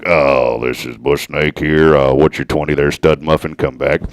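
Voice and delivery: low, loud trucker radio call; CB radio talk. Pretend. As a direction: hail a buddy over the CB radio. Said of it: "I can't even do that".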